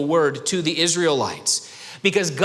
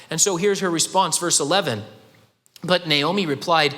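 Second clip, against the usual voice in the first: normal talking voice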